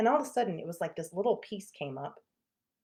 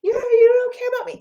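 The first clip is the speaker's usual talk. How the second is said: put-on high, loud whining complaint to the kids; whiny accusation; 16 dB louder than usual. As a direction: whine it out like a hurt mom. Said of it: "I said that even out of my mouth".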